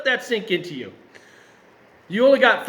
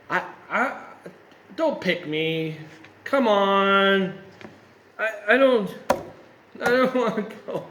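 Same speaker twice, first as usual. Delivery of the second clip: put-on whining little baby; 3 semitones higher than usual. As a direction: whine and plead like a reluctant child. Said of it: "whining like a little baby".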